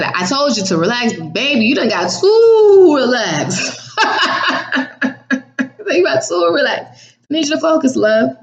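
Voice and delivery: a laughing, teasing God voice, pitched high. Acted. God teasing her for getting too relaxed, through laughter. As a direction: laugh through it, fond and amused. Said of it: "God said".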